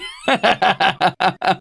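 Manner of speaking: high bright giggling; a high gleeful giggle. Real, not acted. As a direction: laugh it off with glee. Laughing after yippee.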